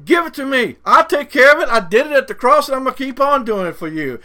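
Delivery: high, loud, impassioned preacher's quote; Jesus. Retold or imitated. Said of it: "That's what he's saying".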